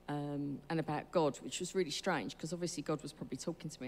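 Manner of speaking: ordinary talk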